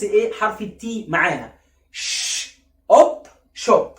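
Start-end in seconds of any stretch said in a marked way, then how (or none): 1.9-2.6 long voiceless "ش"; SH sound. Shown as a demonstration. Teacher sounding out shop for learners, isolated sh. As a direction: hush out "ش" alone, softly sustained. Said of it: "عايزين الاس اتش بس".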